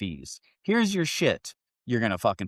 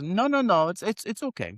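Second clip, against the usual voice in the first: high-pitched, insistent driver impression; imitated kindly driver; 11 semitones higher than usual. Driver refusing to leave passenger on curb, high mimicked reassurance.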